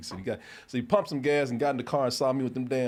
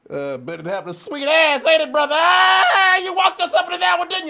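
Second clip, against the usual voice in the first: higher, louder triumphant yell; gloating shout; 10 dB louder than usual. Retold or imitated. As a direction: crow it loudly, pleased with yourself. Brother gloating, shouting the punchline.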